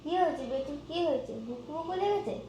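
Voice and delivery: high, sing-song pet talk; cooing high baby talk to a pet; baby talk to the cat. Acted. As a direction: coo at the sleepy kitten in a high voice.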